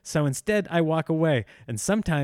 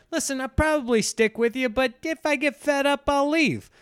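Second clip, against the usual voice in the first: mock spouse vow; 11 semitones higher than usual. Imagined frank spouse's wedding vow.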